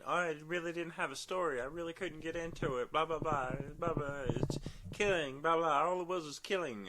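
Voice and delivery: high whiny complaining voice; mocking whiny fan.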